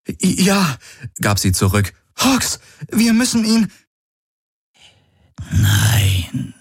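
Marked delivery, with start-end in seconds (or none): 0.1-0.8 high, stammered reply; shaken stammer. Acted. Erschütterte Überlebende antwortet stockend. 2.2-3.7 high-pitched urgent outburst; alarmed urgency. Acted. Figur drängt, dem Verletzten zu helfen. 5.5-6.5 drawn-out eerie moan of a word; whispered ghost voice. A narrator's reading. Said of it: "wisperte eine Geisterstimme".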